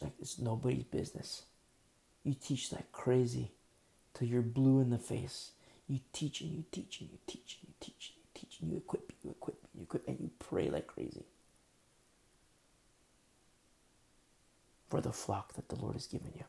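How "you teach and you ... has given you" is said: soft, breathy, urgent repetition; hushed urgency. Real, not acted. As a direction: hush it down and hammer the repetition. Intense hushed exhortation.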